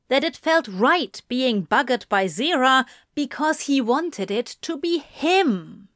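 usual speaking voice

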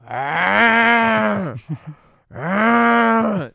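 loud mock snore, drawn-out ah; imitated snoring. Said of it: "It's like a".

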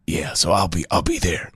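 hint of a gruff sidekick; put-on low voice. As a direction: drop into a low mock character voice. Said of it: "that's not your voice".

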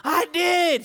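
high, loud eager reply; excited yes. Retold or imitated. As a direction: shout it back, thrilled. Daughter confirming excitedly.

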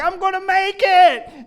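very high, loud, hammed-up cry of triumph; elated shout. Runner cheering himself on at mile eight, high elated shout. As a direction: push the runner's confidence up to a yell.

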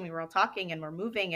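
normal talking voice

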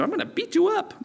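put-on high squeaky little-kid threat; kindergartner. Preacher mocking the nations as a kindergartner bully. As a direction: play a tiny child making a big threat. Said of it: "Like a kindergartner come to you".

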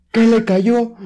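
put-on child screaming. Child at the family party yelling what hit him: high loud shriek. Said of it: "Y que grita un niño".

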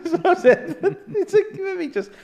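high laughing retell; high, loud laughter while quoting own advice. Real, not acted.

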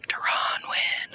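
eerie breathy whisper of a name; little voice whisper. Retold or imitated. The voice in his head whispers a betting tip, whispered. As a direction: whisper it like a voice only you can hear. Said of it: "little voice in my head".

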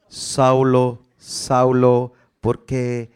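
low, loud commanding call; voice of God. Acted. Preacher voicing God calling to Saul, low and loud. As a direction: call down from heaven, deep and loud.

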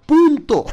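PUNTO punched high and loud; emphatic full stop. Real, not acted. Teacher closing the accent rule for listeners, emphatic PUNTO.

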